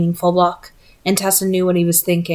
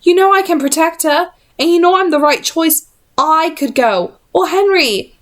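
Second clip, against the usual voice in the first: heated insistence; 8 semitones higher than usual. Acted. Will arguing to go.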